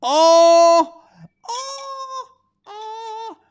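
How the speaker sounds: sustained high-pitched "oh" cries; drawn-out "oh". Pretend. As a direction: echo a long high "oh", fading after the first. Drawn-out reaction to faraway reply.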